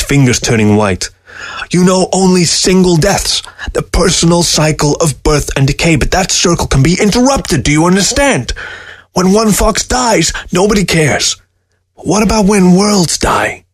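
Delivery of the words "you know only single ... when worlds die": intense warning. A narrator's reading. Newberg gripping table, intense.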